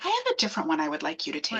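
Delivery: low, soft, quick retold doctor; no panic mode. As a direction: deliver it softly and low, doctor playing it down. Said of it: "Not panic mode or anything".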